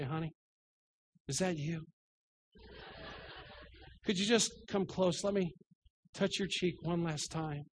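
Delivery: faint, feeble voice of a man who thinks he's dying; mock dying patient. Pastor imitating himself sick in bed, calling his wife, mock feeble. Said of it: "I'm the worst patient in the world".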